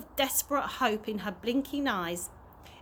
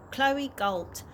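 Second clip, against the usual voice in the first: plain reading voice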